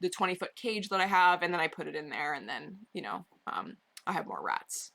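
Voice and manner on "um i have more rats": secret whispered confession; hushed mock-sinister aside.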